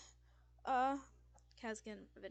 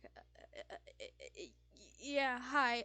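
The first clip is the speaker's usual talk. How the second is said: anxious stammer; 4 semitones higher, 8 dB louder than usual. Acted. Frightened Fisk answering an old roommate, anxious stammer. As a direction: stammer nervously, then force out the greeting. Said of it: "extremely anxious after the loud noises".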